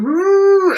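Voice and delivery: rising "woo" of a ship lurching; loud sliding "woo"; mock whoosh. Describing ship footage, mock sway sound. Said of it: "like this".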